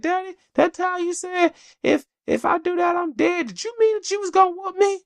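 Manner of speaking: put-on very high chattering child voice; four-year-old daughter. Dad imitating his four-year-old listing random memories, very high child voice. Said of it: "We'll just be sitting there like".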